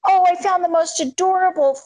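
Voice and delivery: high, gushing imitation of mom; mock-sweet mom gushing over a find; mom's gushing voice. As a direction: gush like an excited mom. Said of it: "My mom will be like".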